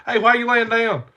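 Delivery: high, loud call down the hill; imitated wife. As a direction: re-enact a wife hollering a puzzled question. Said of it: "She's like".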